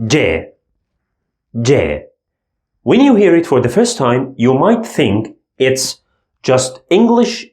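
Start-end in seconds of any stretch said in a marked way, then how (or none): 0.1-2.1 J said with a slight D before it, dj; Arabic letter sound. Shown as a demonstration. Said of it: "we pronounce it as".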